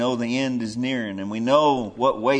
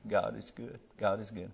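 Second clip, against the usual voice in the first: quiet, softer repeated words; softly repeating; 3 semitones lower, 8 dB quieter than usual. Retold or imitated. Quoting the grieving mother softly to the congregation. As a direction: say it gently, as she did. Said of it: "That's all she could say".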